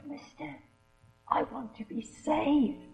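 put-on soft hushed whisper of a little girl; a child's quiet plea. A narrator's reading. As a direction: whisper it close to his ear. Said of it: "she whispered in his ear".

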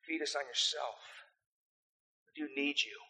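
ordinary talk

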